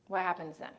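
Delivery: ordinary delivery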